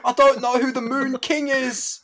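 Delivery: high mock British voice; hammed-up, bewildered British impression; stupid British accent. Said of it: "they're doing a stupid accent".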